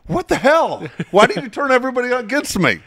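high, loud mock outrage; retold angry rant. Calling Jeff while fleeing, high mock angry yelling. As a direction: yell down the phone like a betrayed friend.

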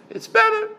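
BETTER pushed up very high; telling inflection. Shown as a demonstration. Rabbi demonstrating his voice to questioner, telling inflection on BETTER. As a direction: say BETTER with a pointed, high-pitched lift. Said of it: "hear the inflection of my voice".

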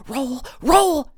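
loud, high hissed command; forceful, panicked urging; urgent hiss. A narrator's reading. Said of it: "Tris hissed".

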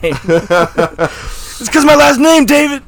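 high, loud, drawn-out character wail; mock Mister Glass cry. Comic as Mister Glass telling David about the name, high mock wail. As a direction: stretch it out high and loud through laughter.

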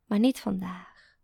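ordinary delivery, nothing marked

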